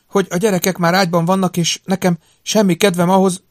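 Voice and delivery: high, even voice reading out a message; recorded hostage wife. A narrator's reading. Andy on tape tells George to obey, recorded voice. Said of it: "Andy Manett hangja felvételről kissé fémézően".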